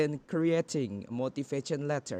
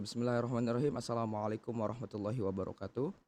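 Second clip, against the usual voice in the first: formal greeting; 3 semitones lower, 4 dB quieter, much faster than usual. Real, not acted. Formal Islamic opening.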